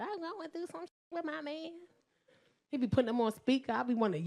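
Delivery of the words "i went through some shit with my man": high, soft whimpering caller; mock crying client. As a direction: imitate a tearful client on the phone. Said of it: "they be calling him crying".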